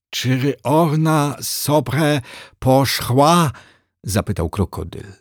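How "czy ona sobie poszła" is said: high, slow crocodile drawl. Acted. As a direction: play the lazy crocodile god, slowly. Crocodile character voice.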